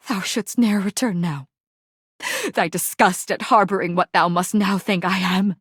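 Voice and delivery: ordinary talk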